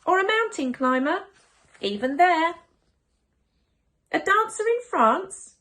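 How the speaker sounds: put-on children firing questions; eager child questions.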